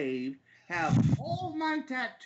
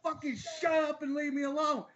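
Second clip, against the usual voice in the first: fast, higher, fed-up retort; mock angry brush-off; 6 semitones higher, faster than usual. Man tells drunk woman at gas station to leave, fast and higher. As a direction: shoo the drunk lady away, quick and sharp. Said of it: "I was like".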